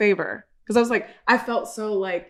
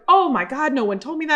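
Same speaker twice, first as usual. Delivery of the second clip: mock shock; 7 semitones higher than usual. She's like, retold shock.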